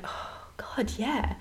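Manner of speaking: whispered realisation that drifts back to voice; hushed mock epiphany; awed whisper. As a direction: breathe it like it finally clicks. Replying to friend's insight.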